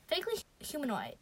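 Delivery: ordinary delivery, nothing marked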